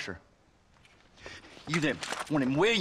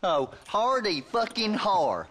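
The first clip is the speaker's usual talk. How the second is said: slow, higher mock laugh in words; sarcastic; 6 semitones higher than usual. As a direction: draw out the sneering retort. Sarcastic retort to a jibe.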